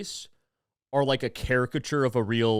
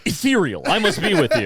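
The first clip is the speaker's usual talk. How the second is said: mock swooning; 4 semitones higher, 7 dB louder than usual. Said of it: "The more I'm like".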